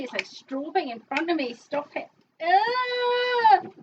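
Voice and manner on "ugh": frustrated cry. Real, not acted. Dizzy from strobing.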